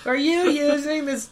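high, slow yell from offstage; from another room. Acted. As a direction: call it through a wall. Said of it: "Eugene yells from the other room".

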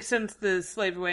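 ordinary delivery

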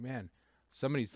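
ordinary talk

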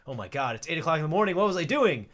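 high, loud and fast mock panic; panicked realization. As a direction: blurt it out in sudden alarm. Gamer realizing it's morning.

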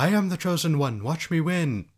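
high, loud mock-heroic proclamation; boastful movie hero. Friend mimics doomed chosen hero from the film, mock-heroic. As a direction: proclaim it grandly, imitating a cocky hero. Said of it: "He's like".

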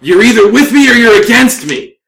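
loud, high battle ultimatum; defiant dwarf. Acted. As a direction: throw down a challenge as an angry dwarf. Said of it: "uh, says".

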